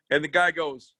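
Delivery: ordinary delivery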